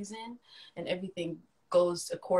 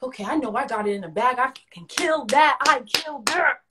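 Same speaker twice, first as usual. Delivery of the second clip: rising, louder, sure of the win; cocky confidence; 6 semitones higher, 8 dB louder than usual. Retold or imitated. A kid bragging to herself after auditions, louder and cocky. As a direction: brag that you nailed it. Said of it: "and I'm like".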